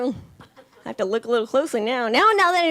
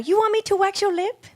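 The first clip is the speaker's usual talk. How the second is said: higher, put-on nail lady voice; 7 semitones higher than usual. Nail lady offering to wax the comedian's lip, higher put-on voice. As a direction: mimic the pushy nail lady. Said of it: "She goes".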